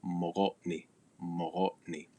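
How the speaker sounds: mogotni with a soft flowing g; "mogotni" with a throaty continuous g; fricative G. Shown as a demonstration. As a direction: let air flow through the g of mogotni. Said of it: "You let it flow".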